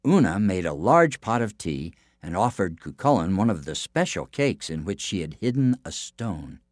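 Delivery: plain reading voice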